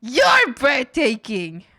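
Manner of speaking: gasping, slow, loud swoon; swooning gush. Acted. Gushing back at the chatter who complimented her.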